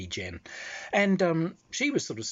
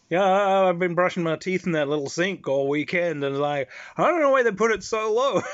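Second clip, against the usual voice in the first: higher, louder, earnest complaint; Mitch explaining; 7 semitones higher, 7 dB louder than usual. Retold or imitated. Mitch telling his friend about the sink, earnest and baffled. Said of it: "He said".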